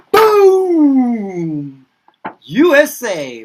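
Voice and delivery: loud, high burst of a triumphant reveal; big reveal. Acted. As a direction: announce it like a showman. Big reveal of forgotten country.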